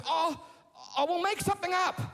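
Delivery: high-pitched, acted dad reaction; exasperated father. Father reacting to the anticlimax, lightly acted exasperation. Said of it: "And Mike goes".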